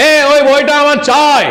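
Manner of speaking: loud slow call in a silent library, shown as a bad example. Shown as a demonstration. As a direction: demonstrate the wrong way by calling out loudly. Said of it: "নিশ্চয়ই না".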